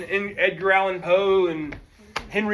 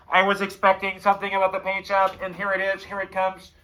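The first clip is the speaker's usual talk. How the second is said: judge voice. Retold or imitated. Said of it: "Let the judge know, like".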